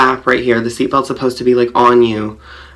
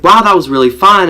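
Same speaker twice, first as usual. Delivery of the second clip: uncle's wow; 6 semitones higher, 5 dB louder than usual. Retold or imitated. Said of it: "he's like".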